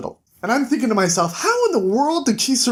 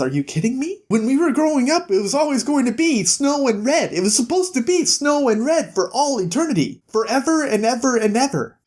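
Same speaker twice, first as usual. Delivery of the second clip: higher, whiny sister rant; jealous sister complaint; 7 semitones higher than usual. Retold or imitated. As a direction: complain like a resentful little sister. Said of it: "Rose says".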